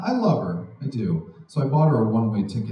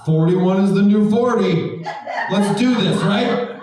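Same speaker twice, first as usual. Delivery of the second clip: hyped up; 8 semitones higher, 5 dB louder than usual. Real, not acted. Hyped declaration to crowd.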